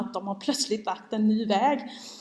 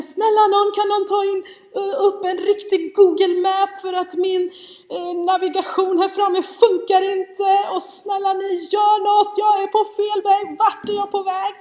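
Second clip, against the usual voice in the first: high, loud hammed-up pleading; panicked yelling; 10 semitones higher, 6 dB louder than usual. Lost mamma at the wheel begging for navigation, re-enacted yelling. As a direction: beg for help in a mock-frantic shout. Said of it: "jag skriker lite".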